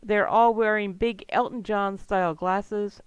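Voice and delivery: normal talking voice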